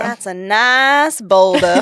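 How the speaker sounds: slow, drawling cartoon sidekick impression; Donkey. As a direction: draw it right out like the movie line. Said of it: "that one I have down".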